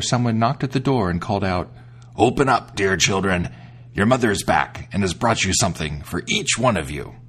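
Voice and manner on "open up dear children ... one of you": low, rough wolf pretending to be mother; gruff wolf voice. The wolf at the door fooling the kids, in a gruff voice. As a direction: growl it out like a wolf in disguise. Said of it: "by his gruff voice".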